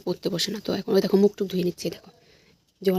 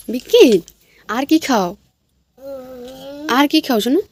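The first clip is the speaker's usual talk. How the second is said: coaxing loud; 4 semitones higher, 6 dB louder than usual. Real, not acted. Mother coaxing her toddler to eat, loud and high.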